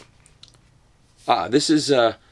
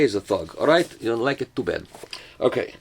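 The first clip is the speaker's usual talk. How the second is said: ordinary talk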